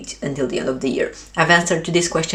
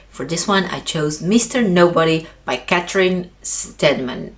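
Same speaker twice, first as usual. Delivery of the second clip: ordinary talk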